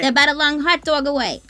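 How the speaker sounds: hammed-up New York accent; mock New York accent. Shown as a demonstration. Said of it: "more Boston to me".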